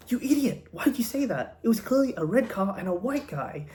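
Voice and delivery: high-pitched, indignant telling-off; exasperated scolding. Acted. Bartender to witness who misreported the robber, exasperated.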